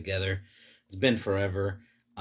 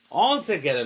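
louder, higher hype echo; excited echo; 6 semitones higher, 5 dB louder than usual. Acted. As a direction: shout it out as a rallying cry. Louder second take.

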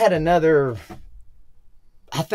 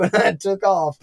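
ordinary delivery

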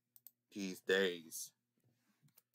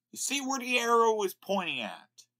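higher, louder repeat; emphatic repeat; 11 semitones higher, 4 dB louder than usual. Real, not acted.

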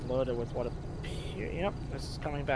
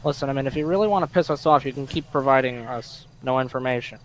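loud, firm, fed-up warning; stern warning; 10 dB louder than usual. Real, not acted. Officer loudly warns the silent suspect he is getting angry. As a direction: lay down the law, loudly.

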